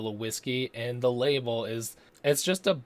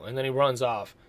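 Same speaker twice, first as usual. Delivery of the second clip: ordinary delivery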